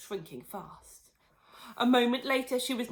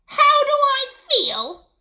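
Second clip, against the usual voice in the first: high, loud, slow furious yell; 16 dB louder, slower than usual. Acted. Tiny Grandma yelling at her daughter. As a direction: yell back in outrage. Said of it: "she yelled".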